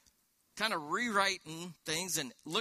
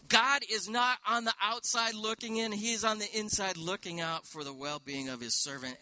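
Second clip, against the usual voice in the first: higher and louder, building to a shout of joy; excited proclamation; 5 semitones higher, 5 dB louder than usual. Real, not acted. Preacher to congregation, excited and loud. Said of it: "I'm so excited".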